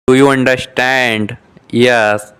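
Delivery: usual speaking voice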